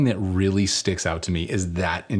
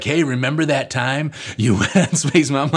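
higher-pitched needling with a laugh; imitated teasing; 6 semitones higher, 4 dB louder than usual. Sisters remind him at gatherings, imitated teasing. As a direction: tease as a sibling would, pitched up.

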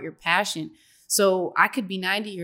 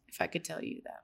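whispered under her breath; whispering; 3 semitones lower, 13 dB quieter, faster than usual. Real, not acted. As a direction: breathe it to yourself. Murmured aside to herself.

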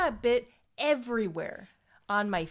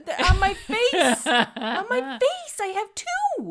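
laughing; 10 semitones higher, 8 dB louder, slower than usual. Real, not acted. Laughing complaint about bites.

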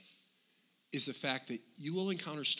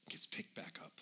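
whisper; 8 semitones lower, 12 dB quieter than usual. Acted. Aside about Peter's restoration.

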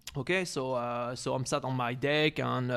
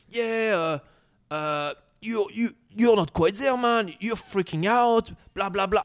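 raised, higher-pitched jeering imitation; mock insulting; 7 semitones higher, 3 dB louder, slower than usual. Neighbor turning on the cat owner, high mock insulting. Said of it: "he started insulting me".

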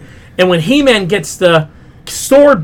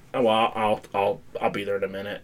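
voiced low and much softer, a mock sheepish father; low mumbled dad; 11 semitones lower, 14 dB quieter than usual.

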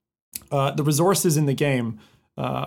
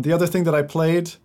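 ordinary talk, nothing marked

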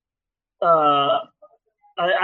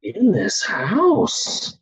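stoned dad; 2 semitones higher, much slower than usual. Retold or imitated. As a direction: drift slowly into baffled alarm. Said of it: "I go like this".

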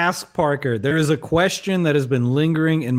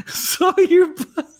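laughing; 13 semitones higher, slower than usual. Real, not acted. Laughing at a silly name.

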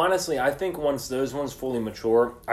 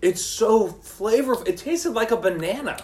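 excited; 10 semitones higher than usual. Real, not acted. Raving about the pepper's flavor.

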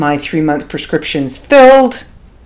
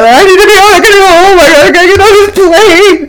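loud, high, put-on crying panic; fake bawling; 14 semitones higher, 5 dB louder, much faster than usual. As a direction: ham up sobbing to win them over. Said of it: "I'm bawling and, and freaking out".

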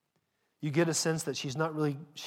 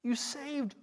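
much higher, ecstatic worship cry; rapturous praise; 10 semitones higher than usual. Acted. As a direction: lift it up in awe. Voicing praise before God's throne.

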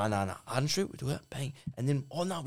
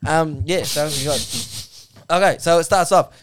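ordinary talk, nothing marked

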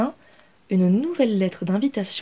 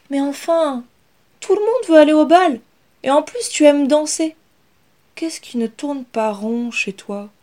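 put-on much higher, louder boy's voice; baffled younger brother; 7 semitones higher, 7 dB louder than usual. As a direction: protest in disbelief. Brother baffled, protesting to Inès.

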